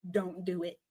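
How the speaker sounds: quiet warning. Acted. Quiet repeat after shout.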